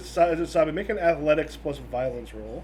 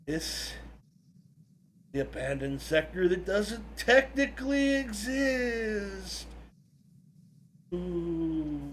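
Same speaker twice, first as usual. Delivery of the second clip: slow, hushed ghost-story voice; spooky storytelling; 2 semitones higher, much slower than usual. A narrator's reading. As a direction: tell it like a campfire tale. Spooky campfire-style reveal.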